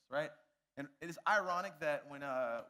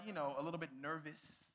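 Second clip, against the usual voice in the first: ordinary delivery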